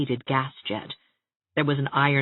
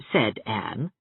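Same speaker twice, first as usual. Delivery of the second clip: ordinary delivery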